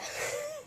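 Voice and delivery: sudden squeaky laugh; laughing as the tale ends; high burst of laughter. Real, not acted. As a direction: let a real laugh break out. Reader laughs after story.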